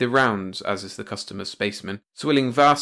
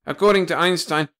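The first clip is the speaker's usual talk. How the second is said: louder, higher objection; protesting; 7 semitones higher, 5 dB louder than usual. A narrator's reading. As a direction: push back against the captain. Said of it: "protested Ugg".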